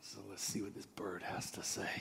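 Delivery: barely voiced, low wary whisper; hushed aside. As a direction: mutter it under your breath. Man's wary aside before approaching.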